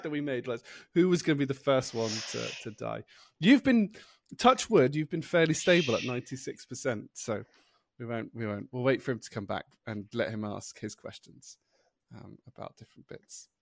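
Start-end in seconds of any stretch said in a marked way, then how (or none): none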